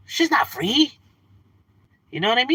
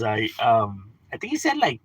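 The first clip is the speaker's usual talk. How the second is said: ordinary talk, nothing marked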